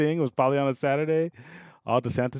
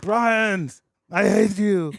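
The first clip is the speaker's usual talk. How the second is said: mock angry yell; 10 semitones higher, 7 dB louder, much slower than usual. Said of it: "And you're like".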